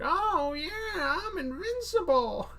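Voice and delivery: high, whiny cartoon hero voice; high whiny Invincible voice; weird whiny voice. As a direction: whine it out like a goofy superhero. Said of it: "some sort of weird whiny voice".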